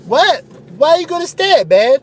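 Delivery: high, loud goofy bro voice; Phil the ad pitchman. Acted. Radio ad buddy Phil calling to Steve, high loud bro voice. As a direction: belt it like a cheesy radio ad buddy.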